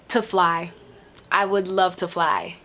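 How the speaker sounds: usual speaking voice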